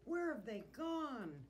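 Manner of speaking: high, loud, slow question; drawn-out worried cry; dismayed cry. A narrator's reading. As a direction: wail it out in dismay. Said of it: "cried Santa".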